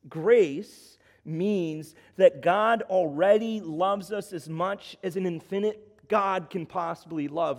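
full-force preaching. Acted. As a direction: preach it hard, pressing the crowd. Preacher climaxes on grace.

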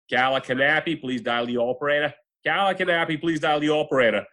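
imitated announcer with a thick Massachusetts accent. Announcer pages Carla at work, in a Massachusetts accent. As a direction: page someone in broad Massachusetts vowels, dropping r's. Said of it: "the announcer was like".

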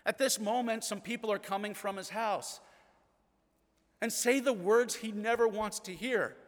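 ordinary delivery

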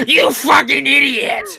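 high, slow bellowing troll yell with a laugh. Retold or imitated. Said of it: "I do my troll voice".

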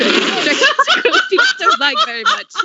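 high laughing British attempt; laughing through it. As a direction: giggle through the line. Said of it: "I can't sing again".

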